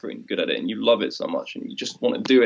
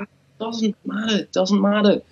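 high, breezy voice brushing every worry aside; mock carefree shrug; 7 semitones higher, 4 dB louder than usual. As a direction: toss it off lightly, it doesn't matter. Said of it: "It's like".

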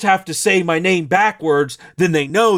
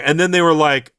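ordinary talk, nothing marked

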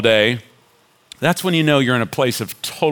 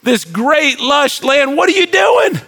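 grumbling Israelites; 11 semitones higher than usual. Retold or imitated. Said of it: "They're looking around going".

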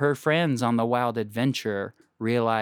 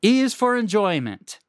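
high, loud show-host announcement; segment title announcement; 10 semitones higher, 5 dB louder than usual. Acted. Host announcing the enjoyment segment, loud and bright.

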